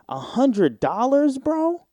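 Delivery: high, slow, stunned; stunned disbelief. Real, not acted. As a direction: drag it out in amazement. Stunned at the gift.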